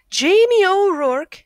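a wife calling her lazy husband sharply; scolding wife. A narrator's reading. Wife calling lazy Jamie, drawn-out scolding call. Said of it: "his wife Eileen would say".

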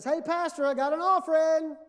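high, loud eager wife's voice. Retold or imitated. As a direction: call it out high and loud, all excited. Wife brings offering to pastor.

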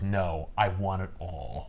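low, soft, slow greedy growl; cold greed. Retold or imitated. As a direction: menace it quietly, low and slow. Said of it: "And he goes".

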